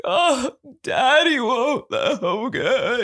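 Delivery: mock toddler wail, high and slow; imitated crying. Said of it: "he's just crying".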